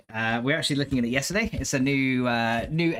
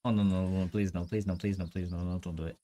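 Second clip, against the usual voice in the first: soft, low pleading through tears; begging not to be made to cry; quiet tearful please, no; welling up; 7 semitones lower, 6 dB quieter than usual. Real, not acted. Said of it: "I don't wanna cry".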